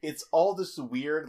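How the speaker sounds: usual speaking voice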